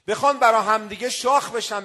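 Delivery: ordinary delivery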